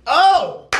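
sudden loud high yelp; loud shout. Real, not acted. Interrupting himself with a sudden loud, high shout. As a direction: blurt it out loud. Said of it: "might have been a bit loud".

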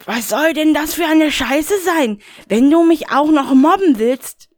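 high, indignant Rainer voice; a high, put-out grumble; Rainer, high and agitated. Acted. Rainer brushes off the caller, high and huffy.

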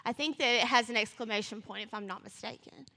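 ordinary talk, nothing marked

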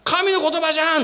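high, wide-eyed discovery; amazed realisation. Retold or imitated. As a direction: blurt it out in amazement. Realising it is God's word.

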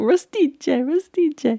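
high, playful imitation of an Italian accent; mock Italian accent. Host imitates Matt teasing with Italian words, mock Italian accent. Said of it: "Matt is doing the Italian accent".

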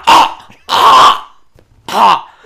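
loud, sharp re-enacted gasping cries; imitated startled choking. Telling mates how he inhaled a pube, imitated startled choking. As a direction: act out being caught off guard, in loud bursts. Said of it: "It caught me so off guard".

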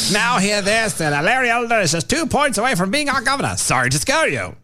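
high herald proclamation; town crier. Retold or imitated. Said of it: "re-reads email as herald".

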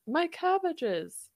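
high, drawn-out mock cry; cartoon catchphrase wail. Fan quoting a favourite Airbender line, high mock wail. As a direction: quote it like a distressed cartoon character. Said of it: "I need to hear".

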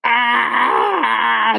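long high-pitched scream; startled yell. A narrator's reading. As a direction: let out a loud, drawn-out shriek. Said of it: "let out a yell".

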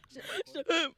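shouting through laughter; laughing. Real, not acted. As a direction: yell it while cracking up. Friends teasing at the mic.

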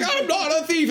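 very high, strained retort; mock yelling. Imitating him shaking her again, mock yelling. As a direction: yell it back, imitating an argument. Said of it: "all this yelling back and forth".

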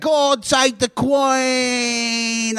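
high, very drawn-out mock British cry; mock British punk accent. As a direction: stretch it out high in hammy British. Mock Sex Pistols impression.

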